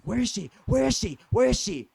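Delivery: imitating an insistent, rising-volume demand; mock demanding shout. Woman storms into restaurant looking for girlfriend, mimicked demanding shout.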